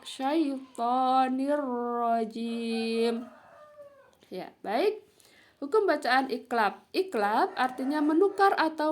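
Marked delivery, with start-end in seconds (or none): 0.1-3.3 SYAITONIR RAJIM chanted very slowly, doubled r; recitation example. Shown as a demonstration. Teacher demonstrating n meeting ro, slow and merged. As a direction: hold long vowels in SYAITONIR RAJIM, merge into the r. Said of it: "Bertemu dengan huruf ro".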